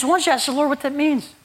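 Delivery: high, lilting imitation of the prayer lady; lightly voiced gentle lady. Preacher imitating the prayer lady asking him, high and lilting. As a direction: pitch it up as the lady guiding him.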